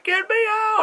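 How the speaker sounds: high-pitched mock cry for help; panicked cry. Woman tangled in spaceship wiring begs the crew, mock panic. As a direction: imitate someone desperate to be freed.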